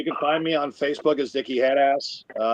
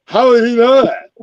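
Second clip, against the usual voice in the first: shocked; 11 semitones higher, 8 dB louder than usual. Retold or imitated. Shocked retold reaction.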